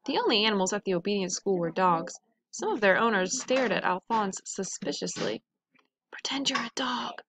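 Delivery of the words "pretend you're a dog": soft unvoiced whisper. A narrator's reading. Louis whispering to his tadpole so others can't hear. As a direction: whisper it so no one hears. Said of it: "whispered Louis".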